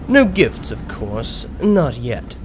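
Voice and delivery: ordinary delivery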